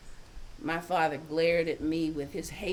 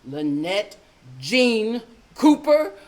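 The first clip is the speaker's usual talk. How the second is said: lowered, slow stern mother; bass voice; 2 semitones higher, 9 dB louder, slower than usual. Retold or imitated. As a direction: drop into a stern mom voice. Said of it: "in a voice that had gone bass".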